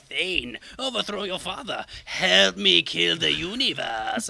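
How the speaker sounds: lightly voiced Death tempting; a hint of a sinister Death urging murder. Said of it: "well, not in that voice".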